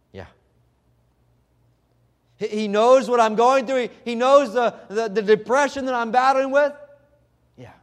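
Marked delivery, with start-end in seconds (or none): 2.4-6.7 rising, louder, higher-pitched proclamation; fervent preaching. Acted. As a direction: preach it out with mounting fire. Preacher voicing believer's discovery.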